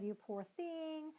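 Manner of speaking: drip with mock sympathy, drawn out; overdoing pity. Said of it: "And so you can really kind of".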